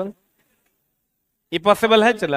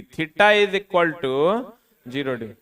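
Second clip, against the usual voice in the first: slow dictation; 3 semitones lower, much slower than usual. Shown as a demonstration. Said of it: "teacher dictating formula".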